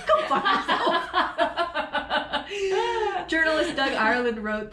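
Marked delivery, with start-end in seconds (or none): 0.1-3.3 high-pitched through real laughter; laughing. Real, not acted. Host jokingly telling off after a tangent, laughing. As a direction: crack up as you swear.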